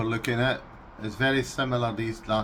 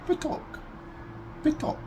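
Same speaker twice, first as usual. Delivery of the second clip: Russian word said high; 4 dB quieter, faster than usual. Shown as a demonstration. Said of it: "in Russian is".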